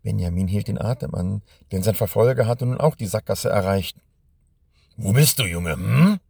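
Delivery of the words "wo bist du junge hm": put-on understated roar, hunting for the boy; mock bellow. A narrator's reading. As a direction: call out roughly, push the final questioning hm. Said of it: "brüllte er".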